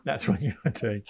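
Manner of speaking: ordinary talk, nothing marked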